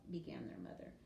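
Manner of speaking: ordinary delivery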